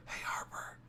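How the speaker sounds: unvoiced whisper, eerie; whispered parrot echo in the dark. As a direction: breathe the name like a creepy parrot. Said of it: "then it would whisper the shit".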